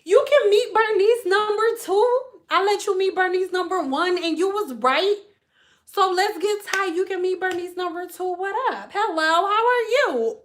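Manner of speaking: a light, high friendly voice; sweet Gemini. Retold or imitated. Gemini warming up to a new friend, light sweet voice. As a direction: lay on the friendliness. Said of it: "y'all be like".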